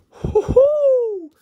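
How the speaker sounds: very high, loud celebratory whoop; joyful whoop. Real, not acted. Card opener celebrating a rainbow pull, high whooping. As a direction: whoop it out in triumph.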